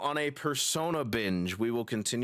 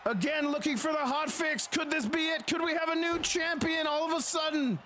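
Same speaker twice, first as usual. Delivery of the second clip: higher, rising hype call; excited wrestling commentator; 14 semitones higher than usual. Acted. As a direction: build the hype for the finish. Title about to change hands.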